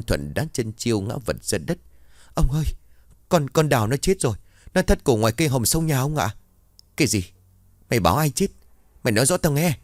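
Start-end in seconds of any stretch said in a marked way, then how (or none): 2.3-6.3 panicked report. Acted. Maid reports death in panic. 7.0-9.8 angry demand. Acted. Master angrily demands answer.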